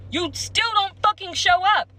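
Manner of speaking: fed-up shout about being stood up; exasperated outburst. Real, not acted. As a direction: voice jumps up high and loud on the complaint. Angry rant about a no-show nail tech.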